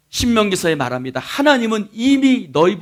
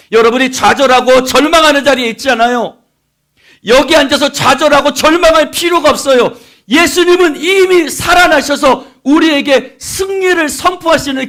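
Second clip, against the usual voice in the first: loud, high, full-force sermon delivery; impassioned preaching; 11 semitones higher, 13 dB louder than usual. Real, not acted. Preacher urging the congregation not to despair, preaching forcefully. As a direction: drive the assurance home at full voice.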